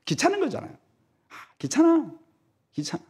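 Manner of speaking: high, soft whining; whiny complaint. Retold or imitated. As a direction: grumble like a bored churchgoer. Sulky churchgoer voiced. (귀찮아하며 투덜대는 목소리)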